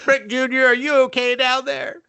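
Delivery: mock-worried voice calling down to Rick Junior; mock concerned call. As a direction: call down to him in a put-on worried voice. Mock woman calling down to him.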